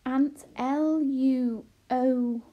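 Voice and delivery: slow, loud, stretched letters; drawn-out deciphering; spelling out. A narrator's reading. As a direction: call out each letter deliberately. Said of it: "began to spell out the letters".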